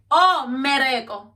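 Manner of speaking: slow, loud mocking "Oh, mereko"; sarcastic scoff. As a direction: throw his excuse back at him, slow and loud. Sarcastic repeat of his excuse.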